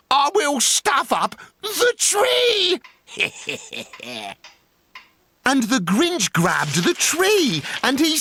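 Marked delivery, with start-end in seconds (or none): 0.1-2.8 much higher, gleeful villain's shout; gleeful Grinch shout. A narrator's reading. The Grinch announcing he'll take the tree, shouting with glee. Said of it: "grinned the Grinch". 3.1-4.3 a very soft, low evil laugh; wicked low laugh. A narrator's reading. The Grinch laughing to himself over the tree, low and soft.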